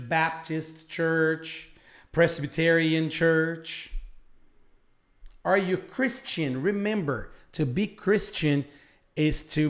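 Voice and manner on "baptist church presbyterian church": slow, clearly spaced church names for learners; slow model answer. Shown as a demonstration. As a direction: spell out each church name slowly. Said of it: "teacher models answer slowly".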